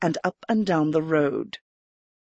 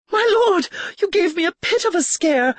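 Erica, startled; 12 semitones higher than usual. A narrator's reading. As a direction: gasp it out as a shaken noblewoman. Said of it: "Erica said".

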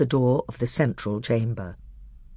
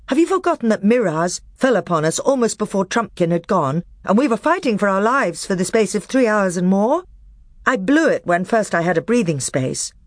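put-on high, loud young king; 8 semitones higher, 5 dB louder than usual. A narrator's reading. Caspian answering the dwarf in council, loud and firm. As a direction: stand firm on your word.

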